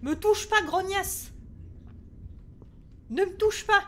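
scared and defiant, pitched high; panicked warning. Real, not acted. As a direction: snap in fear at an attacker. Warding off game monster.

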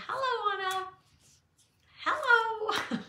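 high, lilting greeting called out; sing-song hello. Retold or imitated. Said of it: "I say".

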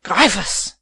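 loud, enraged cry of a name; furious one-word outburst; roared. A narrator's reading. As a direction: roar it at an enemy. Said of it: "roared Van Baerle".

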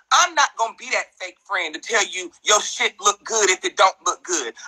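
usual speaking voice

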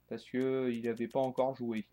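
normal talking voice

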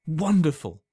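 loud, high, delighted cry; cries out in delight. A narrator's reading. Alan hearing of the potion's power, delighted loud cry. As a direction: burst out with sheer joy. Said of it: "cried Alan".

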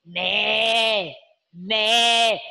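low bleating animal call. Shown as a demonstration. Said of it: "like a goat".

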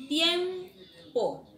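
"tiempo" agudo y fuerte, bien separado; dictado marcado. Shown as a demonstration. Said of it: "tenemos la palabra". ("tiempo" dictado con fuerza)

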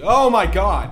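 loud, high shocked outburst; startled cry. Real, not acted. Player startled by something in the house, loud. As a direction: blurt it out in real fright. Sudden scare in game.

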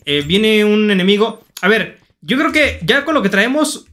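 ordinary talk, nothing marked

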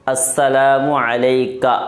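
slow, deliberate "السلام علیکہ"; careful recitation. Shown as a demonstration. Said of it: "یہ ہوگا یہاں تک".

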